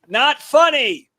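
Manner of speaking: slow, high, drawn-out heckle; mock heckler shout; heckler's yell. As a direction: call it out from the back like a heckler. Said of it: "I'm gonna say as loud as he said it".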